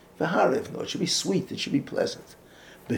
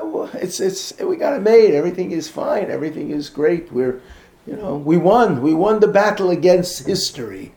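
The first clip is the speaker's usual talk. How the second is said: loud, high, smug mock triumph; mock complacency; 4 semitones higher, 8 dB louder than usual. Speaker mocking complacent community, loud smug triumph. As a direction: ham up the self-satisfied crowd.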